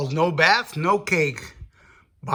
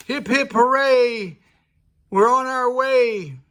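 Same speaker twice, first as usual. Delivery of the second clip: high, loud hooray; excited cheer; 6 semitones higher, 4 dB louder than usual. Acted. Freshly washed pirates cheering to go, high and loud. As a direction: cheer like a happy crew setting off.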